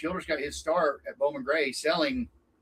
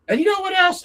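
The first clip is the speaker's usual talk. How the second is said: much higher, louder riled-up retort; fired-up comeback; 8 dB louder than usual. Retold or imitated. Racer turning back at the kid, riled-up retort. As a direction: come back swinging, still heated.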